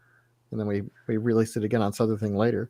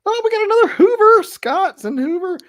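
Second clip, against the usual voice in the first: much higher, delighted squeal; excited; 7 dB louder than usual. Real, not acted. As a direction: gush over the news. Excited about another Hoover fan.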